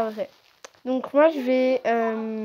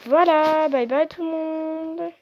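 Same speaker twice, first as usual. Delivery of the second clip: cheerful goodbye; 5 semitones higher, 4 dB louder, slower than usual. Real, not acted. Outro, higher and louder.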